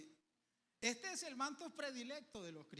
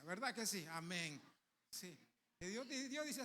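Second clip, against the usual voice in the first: normal talking voice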